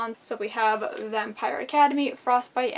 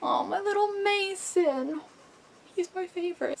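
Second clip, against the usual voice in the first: adoring coo; 8 semitones higher than usual. Real, not acted. Reviewer doting on her favorite character, high-pitched cooing. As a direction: coo over a darling in a tiny high voice.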